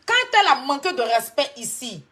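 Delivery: loud accusation at a rival. Real, not acted. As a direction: hit QUAND hard and loud. Heated clash on a live stream.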